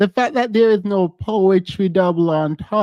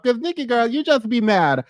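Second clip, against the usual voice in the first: ordinary talk, nothing marked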